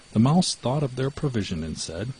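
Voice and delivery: ordinary delivery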